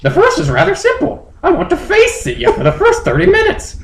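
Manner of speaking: very high falsetto creature; squeaky bird-Pokemon voice; high Moltres voice. Acted. Moltres asking Justin to fly home, squeaky high.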